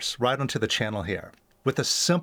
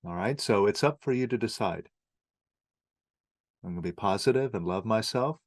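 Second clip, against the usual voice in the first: lower, softer meditation guide with long pauses; guided meditation; 6 semitones lower, 4 dB quieter than usual. Acted. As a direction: gently lead the listener through letting go, leaving long silences.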